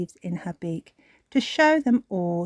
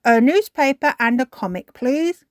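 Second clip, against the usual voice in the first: raised, loud character voice; polite storybook request; 6 semitones higher, 6 dB louder than usual. A narrator's reading. The dogs order from the shop, raised polite request. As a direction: ask for it with a polite lift. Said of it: "Grandad Dog and Danny Dog said".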